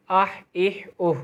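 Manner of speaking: اہ and اوہ with a throat-born h; throaty ha. Shown as a demonstration. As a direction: push the ہ of اہ from the throat. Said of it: "ہا جو ہے وہ حلق سے نکلے گا".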